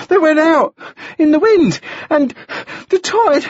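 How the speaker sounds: put-on high panting boy, words in fragments; breath in gasps. A narrator's reading. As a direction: gasp out the news piece by piece. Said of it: "his breath was coming in gasps".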